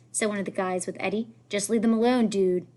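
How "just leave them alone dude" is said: usual speaking voice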